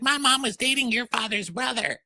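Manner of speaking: high put-on retelling; lightly voiced catty housewife; mock Portia.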